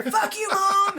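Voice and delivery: high, loud mock yell at his mom; hammed-up angry shout. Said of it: "He punches her. shout".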